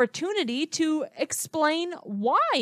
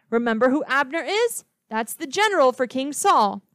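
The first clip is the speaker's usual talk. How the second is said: ordinary delivery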